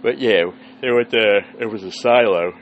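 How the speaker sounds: talking through a yawn; words stretched by a yawn; sleepy yawn mid-sentence. Real, not acted. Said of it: "Sorry for the yawning".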